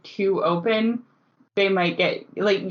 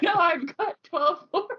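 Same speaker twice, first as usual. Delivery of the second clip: higher voice breaking into laughter; laughing retelling; 10 semitones higher than usual. Retold or imitated. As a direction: imitate the barmaid while cracking up. Retelling barmaid's absurd answer, amused.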